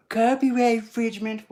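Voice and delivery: high, loud, gasping mock alarm; gasp, then cry out as a scandalized objector; mock outrage. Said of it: "someone might be all like".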